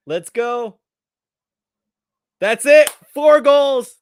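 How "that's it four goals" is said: big, high, loud goal yell; live commentator screaming a goal; excited shout. Real, not acted. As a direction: roar it like the ball just went in. Celebrating a penalty goal live.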